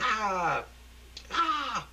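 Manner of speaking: high horrified scream. Acted. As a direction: scream out in horror. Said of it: "Michelle screaming".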